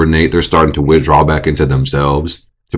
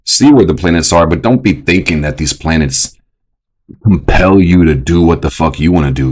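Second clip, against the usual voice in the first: usual speaking voice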